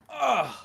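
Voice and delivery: loud, high groan on landing; pained grunt of impact. Acted. Game master voices player falling on their back, pained grunt. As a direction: knock the wind out in one loud groan.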